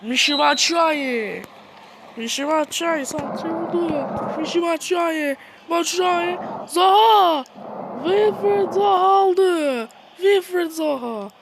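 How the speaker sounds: football commentator calling the play; mock commentary. Gamer commentating his own match like a broadcaster. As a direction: call the game like a TV announcer.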